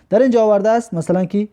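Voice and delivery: usual speaking voice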